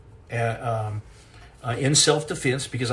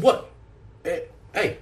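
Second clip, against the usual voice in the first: Italian movie character; 6 semitones higher than usual. Acted. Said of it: "you guys have seen the Italian movie".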